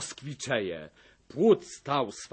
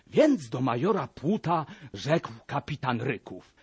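plain reading voice

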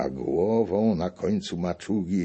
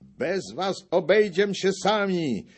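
much higher and louder; defiant shouting; 13 semitones higher, 4 dB louder than usual. A narrator's reading. The sprinkler shouts at the foreign gentry, high and loud. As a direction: shout down the rivals. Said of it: "Kropiciel krzyczał".

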